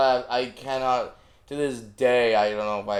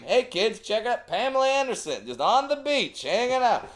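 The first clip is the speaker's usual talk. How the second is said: much higher, bright announcing; goofy dad voice; 13 semitones higher than usual. Retold or imitated. Dad to kids in the car, high and goofy. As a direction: hype it up like a dad pointing out the window. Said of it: "it became".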